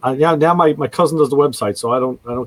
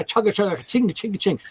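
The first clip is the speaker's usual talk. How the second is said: high-pitched chugging and chinging; imitated car noise; 6 semitones higher, 4 dB quieter than usual. Caller imitating a car fault for the radio hosts, chugging noise. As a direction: mimic a caller making engine noises. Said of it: "can you make the noise".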